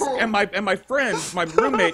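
normal talking voice

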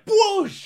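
squealed whoosh effect; a sudden high whoosh noise. Pretend. Sound of unleashing.

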